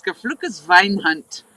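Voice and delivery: "Gefluches Weinhund" said slowly, carefully sounding out the German; German phrase. Retold or imitated. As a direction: spell out "Gefluches Weinhund" slowly like a half-remembered German curse. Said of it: "the only thing I know how to say in German is".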